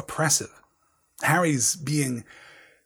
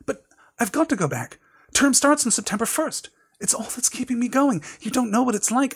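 put-on higher, earnest boy's voice pleading to stay; pleading young Harry; 9 semitones higher than usual. A narrator's reading. Harry pleads with Dobby to let him go back, higher earnest boy voice. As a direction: voice Harry begging not to be kept from school.

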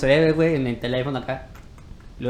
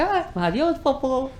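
high, sing-song goodbye to the poop; bright mock goodbye, pitched way up; mock farewell; 10 semitones higher, slower than usual. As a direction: wave it off in a high voice.

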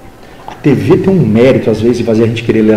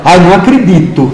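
"acredito" with a hard T, higher pitch; fretful Ibitinga accent, crisp "to" in "acredito"; nervous Ibitinga accent; 4 semitones higher, 3 dB louder than usual. Shown as a demonstration. Local from Ibitinga when upset, hard-T Ibitinga accent. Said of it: "Quando ele tá nervoso, ele fala".

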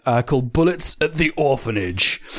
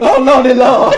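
hammy revival preacher; 9 semitones higher, 7 dB louder than usual. Acted. Mock preacher scene.

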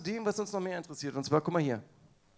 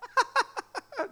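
burst of high laughter; 3 dB louder than usual. Real, not acted. Reacts to quoted lyrics.

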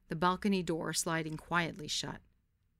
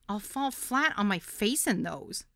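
squeaked; 3 semitones higher, 4 dB louder than usual. A narrator's reading. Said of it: "I squeaked".

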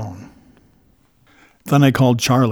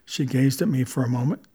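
usual speaking voice

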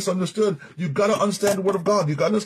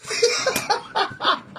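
high wheezy laugh; real high-pitched laughter; 4 dB quieter than usual. Real, not acted. Preacher laughs at own point.

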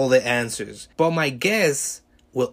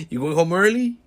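higher, louder forced-casual question; awkward repeat; 6 semitones higher, 4 dB louder than usual. Retold or imitated. Him still dodging the moment, forced bright question. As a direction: push the cheery question again.

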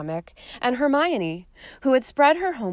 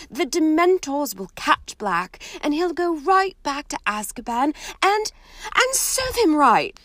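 Hermione voiced higher; 8 semitones higher, 4 dB louder than usual. Hermione reassuring, high.

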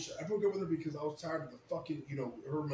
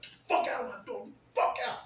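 high and loud, throwing him out; angry yelling; 10 semitones higher, 4 dB louder than usual. Retold or imitated. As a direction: bark them out the door. Throwing people out of the room.

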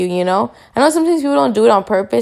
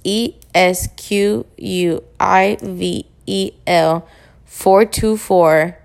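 spelling it out; much slower than usual. Shown as a demonstration. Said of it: "my Twitter handle is".

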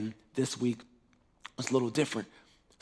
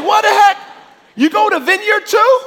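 shocked disbelief; 16 dB louder than usual. Retold or imitated. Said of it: "I'm like".

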